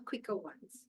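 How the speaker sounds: plain reading voice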